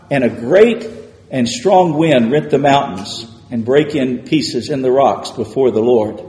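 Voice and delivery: usual speaking voice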